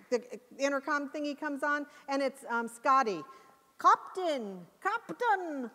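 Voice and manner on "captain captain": a mock Scottish engineer calling the captain; hammed-up Scottish calling out; terrible Scottish accent. Scotty calling the captain over the intercom in a bad Scottish accent. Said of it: "I do a terrible Scottish accent".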